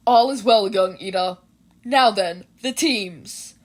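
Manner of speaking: loud booming hero-teacher voice; a big-hearted teacher, loud and sure; All Might. A narrator's reading. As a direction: boom it out like a proud hero mentor. All Might reassures and moves on.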